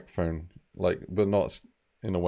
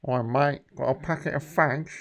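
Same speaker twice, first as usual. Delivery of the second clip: higher-pitched put-on British lad; jokey raised British character voice; British bloke voice; 7 semitones higher than usual. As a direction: cadge off a mate, hammed-up British. Trying out British drunk guy voice.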